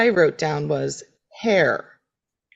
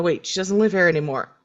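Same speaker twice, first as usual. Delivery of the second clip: usual speaking voice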